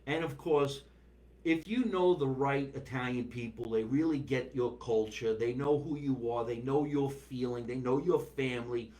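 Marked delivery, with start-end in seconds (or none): none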